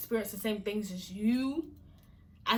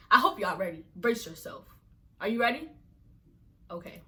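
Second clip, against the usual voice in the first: ordinary delivery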